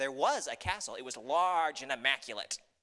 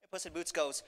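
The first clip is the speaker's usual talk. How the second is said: ordinary delivery, nothing marked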